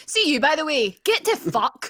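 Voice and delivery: put-on cheeky Glaswegian farewell, Scottish accent. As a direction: say it like a Glasgow auntie, Scottish accent. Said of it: "in a proper strong Scottish accent".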